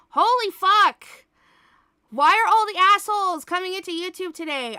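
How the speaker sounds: loud, high, fast exasperated rant; angry rant. Real, not acted. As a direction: blow up at the trolls. Ranting at trolls.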